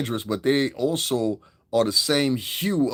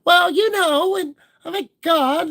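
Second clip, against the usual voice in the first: imitating a host's little voice, much higher and louder; mocking her little voice; 5 dB louder than usual. Mocking the new podcast host's voice, a high put-on little voice. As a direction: go way up high and dainty to mock her. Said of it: "she did that same little voice".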